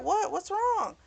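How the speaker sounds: high, startled what's wrong; puzzled surprise. Retold or imitated. Woman surprised when friend is worried, startled. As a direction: pitch up as if caught off guard. Confused when told of worry.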